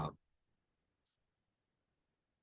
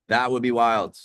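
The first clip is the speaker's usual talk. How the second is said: raised pitch, much louder; excited exclamation; 6 semitones higher, 10 dB louder than usual. Real, not acted. Streamer imagining midstream ads to viewers, loudly excited. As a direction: exclaim it, high and loud.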